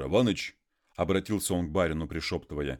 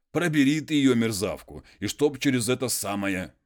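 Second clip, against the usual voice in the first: higher, louder indignant muzhik; worked-up peasant; 6 semitones higher, 5 dB louder than usual. Acted. Konstantin complains of his wife to the master, raised and indignant. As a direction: rail against the wife, raised and loud.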